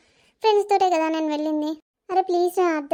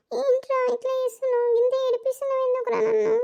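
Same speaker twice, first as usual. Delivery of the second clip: higher, tearful and whiny; crying to her boyfriend, higher and tearful; 8 semitones higher than usual. Acted. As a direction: plead through tears, pitched high. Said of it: "ఏ, ఏడవకు మళ్ళా".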